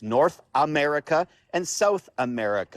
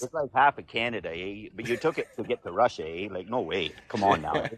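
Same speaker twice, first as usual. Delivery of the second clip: hammed-up Canadian accent full of eh; mock Canadian accent; 4 semitones lower, 3 dB quieter than usual. Complaining Alaska went via Russia, mock Canadian accent.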